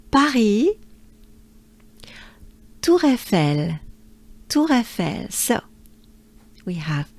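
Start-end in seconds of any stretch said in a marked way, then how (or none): 0.1-0.7 Paris said the French way, throaty R, stress on the end; pa-RI with a uvular R, no final s. Shown as a demonstration. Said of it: "That's how we say it in French". 2.8-5.3 Tour with a throaty French R, Eiffel as eh-fel. Shown as a demonstration. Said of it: "it ends with the French R".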